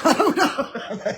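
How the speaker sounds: high, loud burst of laughter; laughing. Real, not acted. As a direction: laugh right through the words, drawn out. Laughs at her own remark.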